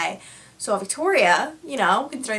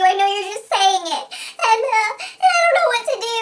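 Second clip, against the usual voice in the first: high, tearful wail of an impersonated friend; Victoria crying hysterically; 12 semitones higher, 3 dB louder than usual. Retold or imitated. Friend reports being dropped by the group, imitated hysterical crying. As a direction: pour it out in a squealing mock cry. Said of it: "I get a phone call from her hysterically crying".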